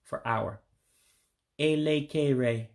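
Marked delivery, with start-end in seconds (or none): 1.6-2.7 "elekere" slowly, syllable by syllable; slow, careful "elekere", ay-lay-kay-ray; slow demonstration. Shown as a demonstration. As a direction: draw out each E like an English A. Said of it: "So I'll do it slowly".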